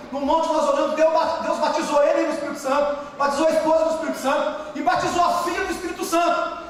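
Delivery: normal talking voice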